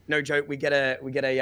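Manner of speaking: normal talking voice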